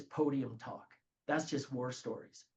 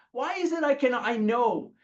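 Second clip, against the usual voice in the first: loud, high, worked-up complaint; exasperated rising rant; exasperated outburst; 7 semitones higher, 7 dB louder than usual. Real, not acted. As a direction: throw up your hands at the unfairness. Exasperated rant about fear.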